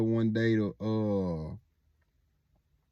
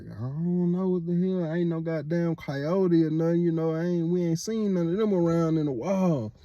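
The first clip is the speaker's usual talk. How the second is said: lightly voiced uncle, pitched higher, unbothered; a hint of a puzzled older relative, higher up; half-acted higher voice shrugging off coyotes; imitating Uncle Shaq; 7 semitones higher than usual. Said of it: "the Shaq was like".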